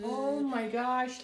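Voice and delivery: ordinary delivery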